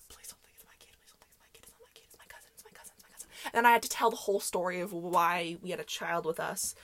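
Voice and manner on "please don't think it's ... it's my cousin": frantic whispered pleading; hushed mock-panicked muttering. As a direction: whisper it over and over, desperately. Panicked inner plea to neighbor.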